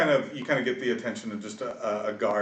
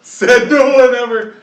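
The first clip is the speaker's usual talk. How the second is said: much higher and louder, slow and drawn out; mock exaggerated quip; 13 semitones higher, 18 dB louder, slower than usual. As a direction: overplay the sarcasm big. Echoing the sarcastic quip.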